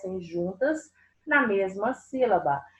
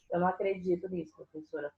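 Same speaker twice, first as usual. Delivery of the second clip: a hint of a disbelieving pupil, lower and softer; aluno incrédulo; 4 semitones lower, 8 dB quieter, much faster than usual. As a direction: mutter mock disbelief quickly, as a pupil would. Voices a disbelieving pupil's reply. (imitando de leve um aluno incrédulo, baixinho)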